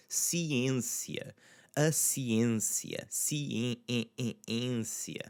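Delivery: "ciência" with a nasalised closed ê, drawn out; slowly nasalise the ê before the n in "ciência". Shown as a demonstration. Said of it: "nasally sound with it".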